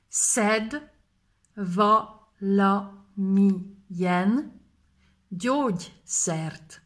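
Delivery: each word drawn out, x0.5 at the end; slowly split. Shown as a demonstration. Teacher breaks the phrase apart for students, slowly. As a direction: stretch every word for the learners. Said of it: "split into words".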